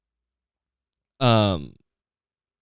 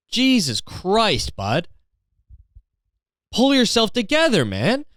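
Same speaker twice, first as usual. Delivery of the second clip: exasperated rant; 5 semitones higher than usual. Real, not acted. Ranting at the man who buys Takis without money, exasperated.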